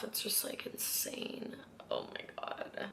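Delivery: whispered, overwhelmed aside; whispering. Real, not acted. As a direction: breathe it out in a whisper. Overwhelmed by the clutter.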